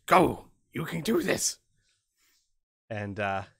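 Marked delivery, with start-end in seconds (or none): 0.1-1.6 loud urging from a second goblin; loud, put-on goblin cheerleader; deeper goblin voice. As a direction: bark encouragement at the little goblin. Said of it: "deeper voice".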